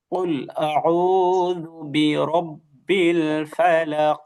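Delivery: slow chanted model, ফালাক ending on a bouncing qaf; measured tajweed model of আউযু বিরব্বিল ফালাক; qalqalah demonstration. Shown as a demonstration. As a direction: recite slowly, echoing the final sound of ফালাক. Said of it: "কলকলাটা উচ্চারণ হয়নি যথাযথভাবে".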